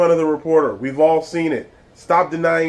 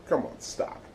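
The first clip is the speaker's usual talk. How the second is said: whispered plea; 10 dB quieter than usual. Real, not acted. Exasperated plea to Trump.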